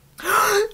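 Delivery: a sudden high gasp of delight; sharp excited gasp. Real, not acted. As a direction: gasp as the pick is revealed. Opening the random book pick.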